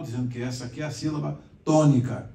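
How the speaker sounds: ordinary talk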